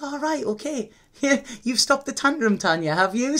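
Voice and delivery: high, amused mock scolding with a laugh; teasing Spirit voice. Spirit teasing Tanya over her tantrum, high and amused.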